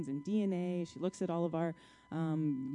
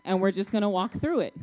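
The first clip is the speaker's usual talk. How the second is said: bold declaration; 7 dB louder than usual. Retold or imitated. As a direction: plant your feet and proclaim it. Husband's faith declaration retold.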